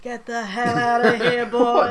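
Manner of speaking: loud, slow, laughing brush-off; loud dismissal. Retold or imitated. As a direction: bark it at him, half-laughing. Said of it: "and I'm like".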